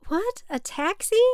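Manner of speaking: high, startled what, a taxi; stunned she'd do something so absurd; shocked disbelief. Acted. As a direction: pitch jumps up in disbelief. Daughter hears of taxi trip.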